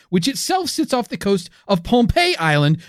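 usual speaking voice

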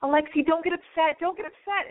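high, mock-pleading man; high-pitched imitation of urgent placating. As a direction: plead anxiously, imitating a worked-up date. Retold fight, man pleading with her.

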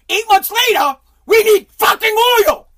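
high furious shout. Retold or imitated. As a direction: scream it in outrage. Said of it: "repeat, far louder and higher".

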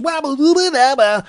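shrieked rock scat; high loud scat. Pretend. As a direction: wail a nonsense riff. Scat after song.

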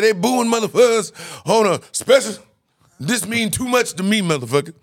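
agitated complaint. Retold or imitated. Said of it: "Bernie said".

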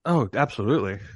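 usual speaking voice

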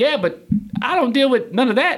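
high, protesting retold comeback; defensive reply. Corporate guy excusing himself to the lady, defensive.

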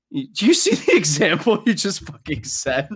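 high-pitched, laughing disbelief; incredulous scolding. Retold or imitated. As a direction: call out the hypocrisy, cracking up. Mock scolding of the dad, laughing.

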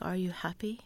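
a father's quiet question, whispered. Retold or imitated. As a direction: hush it like a gentle retold question. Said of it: "he's like".

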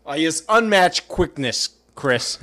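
loud, slow, emphatic sportscaster impression; punchy TV pundit parody; Mel Kiper. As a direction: belt out hammed-up draft-analyst praise, drawn out. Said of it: "cut to Mel Kiper and he'd be like".

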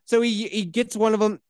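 ordinary talk, nothing marked

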